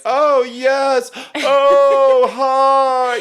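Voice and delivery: hammed-up operatic chitchat; opera singer talking. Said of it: "they're talking like this on the couches".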